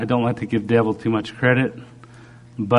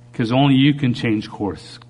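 usual speaking voice

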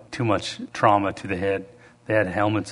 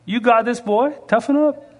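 tough dad; 10 semitones higher than usual. Retold or imitated. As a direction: push your boy to toughen up. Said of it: "he's like".

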